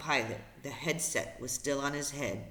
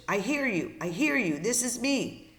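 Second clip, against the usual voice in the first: eager, higher-pitched radio answer; urgent reply; 7 semitones higher, 3 dB louder than usual. Acted. Brian answers the voice on the radio, urgent reply. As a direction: jump in urgently so they don't lose you.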